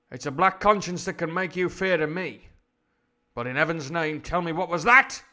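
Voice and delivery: high, loud melodramatic demand; hammed-up rough Londoner from a fifties film; fifties Hollywood cockney. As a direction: declaim like an old-film actor, building to an alarmed question. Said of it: "upper class Hollywood actor".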